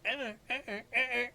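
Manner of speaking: high squeaky dolphin chirps; Flipper dolphin chatter. Retold or imitated. Comparing his panic to Flipper, high dolphin squeaks. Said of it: "It's like Flipper".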